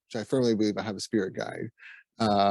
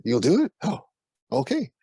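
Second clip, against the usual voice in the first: surprised owner; 6 semitones higher, 4 dB louder than usual. Retold or imitated. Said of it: "he's like".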